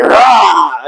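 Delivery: mock boss roar of approval; Vince's approving roar. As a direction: bark it like a delighted boss. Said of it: "And Vince went".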